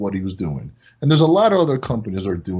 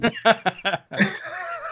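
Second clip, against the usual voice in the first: high laugh; 13 semitones higher, 3 dB quieter than usual. Real, not acted. Laughs at his own story.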